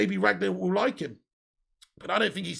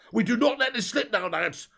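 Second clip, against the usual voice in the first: higher-pitched Cockney impression of a rallying cry; Cockney accent; 4 semitones higher, 3 dB louder than usual. Streamer mimicking Gerrard's team talk, mistakenly in Cockney. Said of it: "said it in a Cockney accent".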